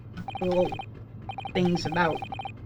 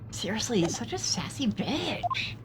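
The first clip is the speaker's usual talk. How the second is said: unvoiced whisper under the breath; whispered aside; 9 dB quieter than usual. Real, not acted. Gamer whispers an insult about the sailor aside. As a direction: whisper it so the sailor won't hear.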